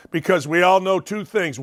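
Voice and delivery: ordinary delivery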